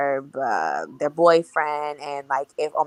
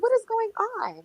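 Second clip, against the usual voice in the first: shocked disbelief. Retold or imitated. Shocked by girls cutting themselves.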